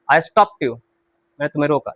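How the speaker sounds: ordinary talk, nothing marked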